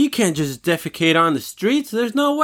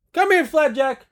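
calling the dog; 11 semitones higher than usual. Real, not acted. Calls his dog over.

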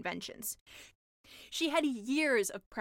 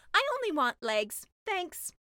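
higher, louder polite refusal; mermaid voice; 7 semitones higher, 5 dB louder than usual. Acted. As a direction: brush it off brightly.

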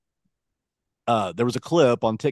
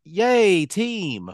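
high, loud mock cheer; mock cheer like a cheerleader; 9 semitones higher, 4 dB louder than usual. Shown as a demonstration. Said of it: "it won't be like".

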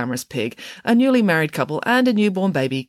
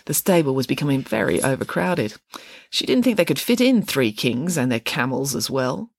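usual speaking voice

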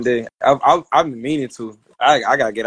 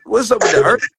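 mock surprised; 8 semitones higher than usual. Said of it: "I'm like".

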